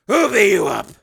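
urgent loud question; loud demand. A narrator's reading. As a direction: demand it loudly. Father demands.

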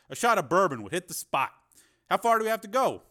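a lightly drawled, easygoing Westerner; relaxed out-of-towner, voice lifted and loud. A narrator's reading. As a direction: Cooper accepts bourbon from the sergeant, easygoing and loud. Cooper speaks to the sergeant.

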